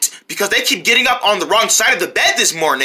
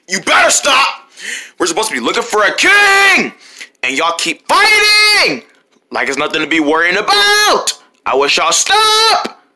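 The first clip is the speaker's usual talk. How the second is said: yelling. Acted. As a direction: yell at them to quit fighting. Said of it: "Do you gotta keep yelling?".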